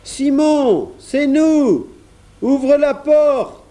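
very high, loud calls, drawn out at the end; high boyish hollering; calling out. A narrator's reading. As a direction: shout through a closed door to be let in. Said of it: "cria Jean".

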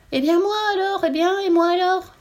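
very high, loud squealing piglet; piglet crying out. A narrator's reading. The piglet running in, crying to the little god, high and loud. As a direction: squeal it like an outraged little pig. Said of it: "en criant de toutes ses forces".

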